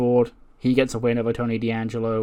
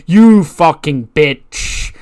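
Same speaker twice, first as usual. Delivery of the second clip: loud, drawn-out insult, slightly higher; acted angry shout; 4 semitones higher, 10 dB louder, much slower than usual. Unseen woman confronts her rival, drawn-out angry shout. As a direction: hurl it out slowly, stretching each word.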